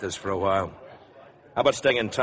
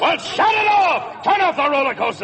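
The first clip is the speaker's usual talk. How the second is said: shouted order over the crowd; barking orders at a fairground; urgent shout; 8 dB louder, slower than usual. Acted. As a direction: yell it so the men hear. Captain orders men during chase.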